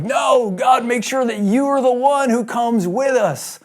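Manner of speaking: a desperate prophet crying out to God; pleading shout. Acted. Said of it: "he's pleading with God now".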